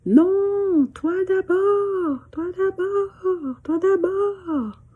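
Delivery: high, slow repeats fading away; echo. Acted. Mountains echoing the girl's insistence. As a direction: let it come back from far off. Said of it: "echo of her words".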